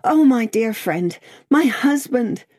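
high, urgent plea; panting, imploring. A narrator's reading. Lucy in her old friend's arms, panting imploringly. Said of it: "she panted out in his arms imploringly".

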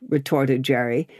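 usual speaking voice

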